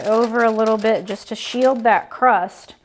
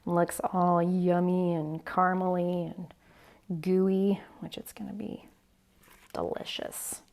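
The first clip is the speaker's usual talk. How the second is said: soft unvoiced whisper; 2 semitones lower, 8 dB quieter than usual. Real, not acted. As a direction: whisper it close to the mic. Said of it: "whispered opening".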